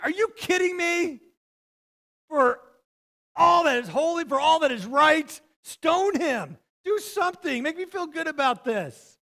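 loud, high-pitched cry of outraged disbelief; exasperated outburst. Retold or imitated. Younger brother protesting his brother's offer of a ride, exasperated outburst. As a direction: plead at full volume in hammed-up exasperation.

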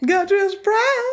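mocking singsong voice. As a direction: squeal "surprise" to annoy her. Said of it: "Stop it".

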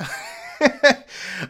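short high burst of laughter; real laugh at his own joke. Real, not acted.

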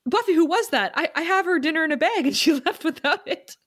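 high, fast, ditzy mom voice; oblivious mom. Retold or imitated. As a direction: fuss about like a clueless caring mother. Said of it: "She's like".

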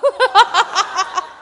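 loud, high wail in rhythmic bursts; hammed-up boo-hoo sobbing; mock crying. Jokes that crying is her talent.

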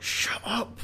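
whispered, urgent shushing; urgent hushing. Acted. Tim hushes coughing Lex as danger nears, urgent whisper. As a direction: whisper it to silence someone. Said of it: "And then Tim's like".

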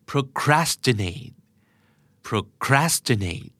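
procrastinate with CRAS stressed, said slowly; second-syllable stress. Shown as a demonstration. Teacher showing where the stress goes in procrastinate, stressed second syllable. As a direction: lean on the second syllable of procrastinate, falling away after it. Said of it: "stress พยางค์ที่สองนะครับ".

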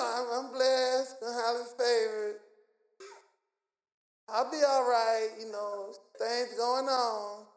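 mock half-hearted positivity; unconvinced confession. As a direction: claim blessings with no confidence at all. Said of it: "You gotta talk with confidence".

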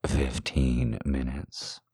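low, slow, hushed murmur; ear whisper. Acted. Man leaning down to her ear, low slow whisper. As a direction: lean in and whisper it slowly. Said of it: "whispers in my ear".

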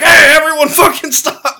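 high, loud yell breaking into laughter; exasperated yell. Retold or imitated. Owner running outside to fighting dogs, exasperated yell. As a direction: yell over the chaos.

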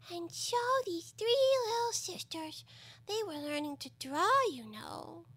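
high, slow little creature voice; sleepy dormouse storyteller. Acted. Dormouse tells of three sisters, in a high creature voice. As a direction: tell it in a high, drawn-out squeak.

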